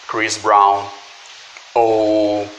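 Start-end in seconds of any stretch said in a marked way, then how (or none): 0.1-0.8 Chris Brown with the English R and a short i. Shown as a demonstration. Teacher repeats the English [name], slightly louder. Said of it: "esse [name] vai virar [name]".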